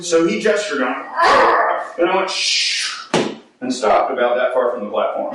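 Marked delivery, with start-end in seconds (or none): 1.1-1.8 high startled yelp as the brake hits. Retold or imitated. Braking hard on the zipline, a high startled yelp. 2.3-3.1 brake hiss. Retold or imitated. Imitating the brake noise.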